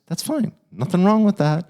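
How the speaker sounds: high-pitched eager that's fine; emphatic reassurance. Real, not acted. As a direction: reassure the listener firmly.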